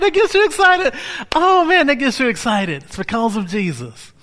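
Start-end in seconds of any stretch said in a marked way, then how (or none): 0.0-2.8 high and loud joyful exclaim; excited cry. Real, not acted. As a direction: let the joy burst out.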